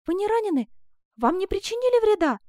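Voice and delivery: high, loud worried questions; daughter's urgent, fearful cry; anxious exclamation. A narrator's reading. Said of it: "воскликнула она".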